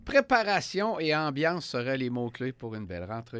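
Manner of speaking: upbeat radio host sign-off; punchy morning show announcer. Acted. Radio host wrap-up and station ID. (animateur radio enjoué)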